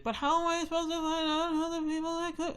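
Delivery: put-on the son asking, high and childish; high kid voice. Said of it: "He's like".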